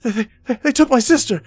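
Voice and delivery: put-on high, loud, stammering terrified boy; hysterical boy. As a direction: blurt it out in panic, tripping over the words. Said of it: "he's kind of hysterical".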